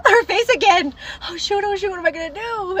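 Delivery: gasping, high-pitched alarm; gasp, then fret high above your normal voice; mock panic. Daughter re-enacting mom calling back: high, flustered panic.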